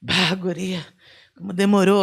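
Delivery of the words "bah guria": normal talking voice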